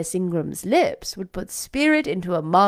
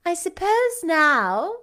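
sarcastic; 9 semitones higher, 4 dB louder, slower than usual. A narrator's reading. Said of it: "curling her lips sarcastically".

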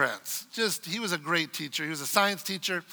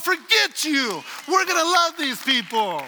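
raised, slow and emphatic; bold declaration; 8 semitones higher, 4 dB louder, slower than usual. Acted. Preacher declaring the church's answer to the world, loud and emphatic. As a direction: proclaim it loudly to the world.

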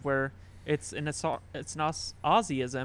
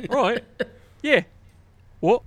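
louder, higher Aussie "Right, yeah, what?"; Aussie accent; 8 semitones higher, 9 dB louder than usual. Retold or imitated. Reenacting the three-word Aussie conversations, loud mock Aussie accent. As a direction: bark it out like a mock Aussie bloke. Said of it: "in an Aussie accent".

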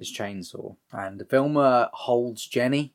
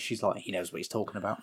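usual speaking voice

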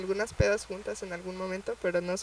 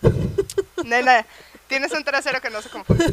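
cheeky teasing with a laugh; laughing tease; 6 semitones higher, 8 dB louder than usual. Real, not acted. (burla entre risas)

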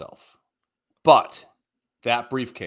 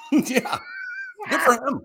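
high, loud laughing shout; delighted high whoop; laughing cheer; 4 dB louder than usual. Real, not acted. Laughing exclamation about friend's story.

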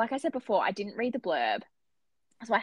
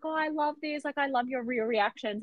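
higher-pitched gushing imitation; delighted author; 5 semitones higher than usual. Author replying to an upset fan, delighted. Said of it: "And he's like".

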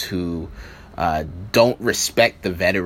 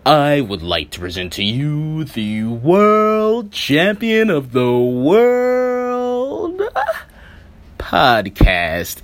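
booming, drawn-out ring announcer voice; grand entrance announcement; 3 semitones higher, 5 dB louder, slower than usual. Acted. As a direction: stretch it out like a wrestling ring intro.

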